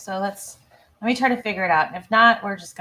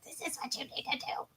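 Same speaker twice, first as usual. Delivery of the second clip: hushed, quick whisper of an inner bad voice; Evil Amy; 4 semitones higher, 14 dB quieter, faster than usual. Alter ego telling the host what to do, sinister whisper. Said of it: "Evil Amy is just like".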